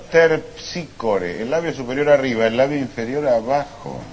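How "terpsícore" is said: "Terpsícore" said very slowly, every sound spelled out; careful pronunciation. Shown as a demonstration. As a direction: stretch out "Terpsícore" syllable by syllable. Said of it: "Fíjese cómo lo pronuncio yo".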